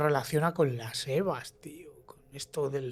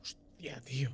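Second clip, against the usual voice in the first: awed hushed whisper; 3 semitones lower, 14 dB quieter than usual. Real, not acted. Co-host reacting to the trapped-in-a-box story, stunned whisper. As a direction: breathe it out in shock.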